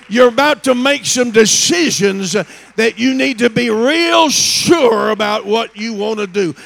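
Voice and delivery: loud, building sermon climax, pressing the crowd; preaching at full force. Acted. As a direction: preach it hard and rising, calling them to decide. Preacher builds to full altar call.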